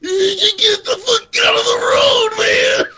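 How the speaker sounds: loud, high, strained shout; acted road-rage bellow from the throat; all-throat rage. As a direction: scream at the car blocking the road. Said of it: "it's all throat".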